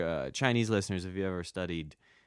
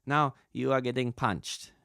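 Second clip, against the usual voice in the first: louder, raised-pitch studio instruction; imitated Chinese sound engineer; 5 semitones higher, 5 dB louder than usual. Sound engineer telling voice actor he is getting punched, deliberate cue. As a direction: announce the next action deliberately. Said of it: "Chinese sound engineers".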